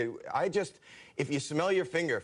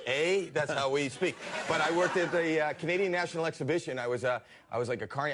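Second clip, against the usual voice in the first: ordinary delivery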